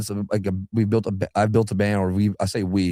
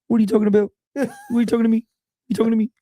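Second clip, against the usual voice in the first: high, put-on Australian cartoon voice; 13 semitones higher, much faster than usual. Fan quoting the cartoon in a high Australian accent. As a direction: quote the Big Les Show in a high Australian accent. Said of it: "For all the fans out there that watch The Big Les Show".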